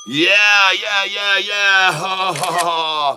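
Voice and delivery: high, loud victory yeahs; triumphant cheering at the price; celebrating yeah. Real, not acted. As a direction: cheer like you just won.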